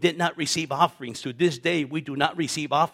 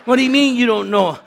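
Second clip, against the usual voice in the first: higher, louder, incredulous shout; angry father-in-law; 8 semitones higher, 10 dB louder than usual. Retold or imitated. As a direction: bark it back like an outraged dad. Father-in-law scolds over quitting job.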